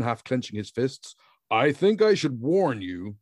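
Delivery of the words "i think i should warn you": ordinary delivery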